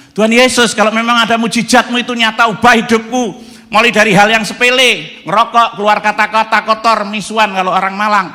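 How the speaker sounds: high, loud pleading prayer; crying-out prayer. Retold or imitated. Desperate man praying aloud to Jesus, high and loud. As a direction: cry out to God for change.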